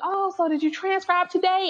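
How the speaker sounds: high, casual mock-husband voice; innocent husband. Husband home from work asking about progress, high innocent imitation.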